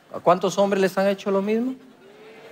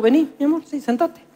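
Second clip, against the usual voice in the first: higher, honeyed mock tenderness toward his wife; sweet invitation; 8 semitones higher than usual. Preacher calling his wife back after cooling off, sweetly. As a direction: coax her back, all sugar.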